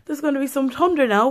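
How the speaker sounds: high, quick, scared outburst; panicked dread. Retold or imitated. Seeing lightning at the window, a high scared rush. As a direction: blurt it out in a rush, dreading thunder. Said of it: "And I was like".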